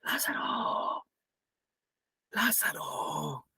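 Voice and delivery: slow, ghostly whispered call from far away; voice from beyond the grave. Retold or imitated. Preacher imitating the distant voice from the old song, slow ghostly whisper. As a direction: call his name like a faint echo out of the tomb. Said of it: "como una voz de, de, de lejos, de ultratumba".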